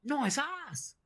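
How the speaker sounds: high falsetto leer; bloke's catcall. Retold or imitated. Bloke in town catcalling, high falsetto. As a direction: squeak it out like a leering bloke. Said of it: "another bloke goes".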